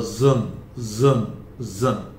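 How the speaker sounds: जन said with a buzzing zh, slow; vibrating zh sound. Shown as a demonstration. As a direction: let the j in जन vibrate like zh. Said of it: "बोलते हैं तो vibrate होता है".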